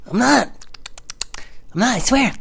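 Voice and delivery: high mock-innocent protest; hammed-up high denial; panicked denial. Son denies it to mom.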